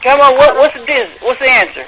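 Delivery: ordinary delivery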